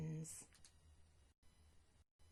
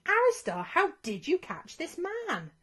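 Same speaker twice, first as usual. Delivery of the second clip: much higher, loud shocked shout; dragons shouting; 9 semitones higher, 7 dB louder than usual. Acted. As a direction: shout across the lair in shock. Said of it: "The dragons shouted".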